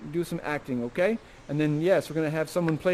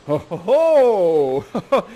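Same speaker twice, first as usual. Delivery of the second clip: hearty laugh; 7 dB louder, much slower than usual. Acted. He goes ho ho.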